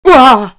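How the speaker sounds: loud, high yell of fright; startled scream. A narrator's reading. Ruprecht frightened by exploding bangers, startled scream. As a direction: yell out as if scared to death. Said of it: "schrie Ruprecht, zu Tode erschrocken".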